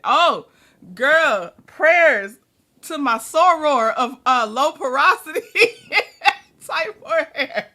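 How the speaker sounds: big, loud prayers to a viewer; emphatic sympathy. Real, not acted. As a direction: call out your sympathy full voice.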